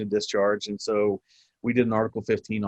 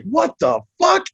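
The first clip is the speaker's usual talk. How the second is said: high, loud, drawn-out yell; explosive outburst, pitched way up; yelling in outrage; 7 dB louder, slower than usual. Retold or imitated. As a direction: yell it in disbelief, stretching each word. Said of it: "I'm, like, yelling".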